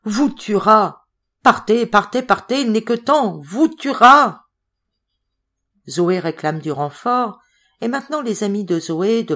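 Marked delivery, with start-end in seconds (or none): 0.0-4.5 loud, high, dragged-out cries; frantic urging. Acted. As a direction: push them out screaming, slow on tuera. Zoé pousse Madeleine dehors. (cris affolés, forts et aigus)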